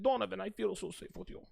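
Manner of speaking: softly imitated Ukrainian accent; thick Ukrainian accent. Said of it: "in her thick Ukrainian accent".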